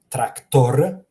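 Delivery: "tractor" loud, final R strongly trilled; rolled R. Shown as a demonstration. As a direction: roll out the last R of "tractor", rising. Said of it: "roll the R".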